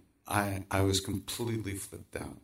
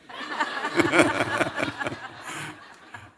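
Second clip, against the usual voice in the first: breathless laughter. Real, not acted. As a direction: wheeze with laughter. Laughing at his own story.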